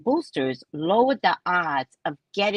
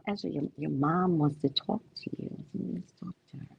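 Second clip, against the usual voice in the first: put-on low, soft coaxing of a child; gently steer the upset kid; hushed, lower acted reassurance; 4 semitones lower, 8 dB quieter than usual.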